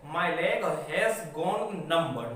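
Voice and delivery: very slow, each word laid out; teacher's slow careful read; slow model sentence. Shown as a demonstration. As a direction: dictate it slowly for learners. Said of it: "teacher models English sentence".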